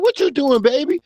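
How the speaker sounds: lightly put-on New Orleans accent, pitched high; imitated Southern accent. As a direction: playfully mimic a friendly Southern woman's accent. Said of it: "fell in love with the accent".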